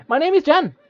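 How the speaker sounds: high chirpy little fairy voice; tiny creature. Acted. Tiny creature introduces itself to the traveler, high and chirpy. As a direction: pipe it up like a bird-sized sprite.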